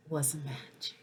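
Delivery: ordinary delivery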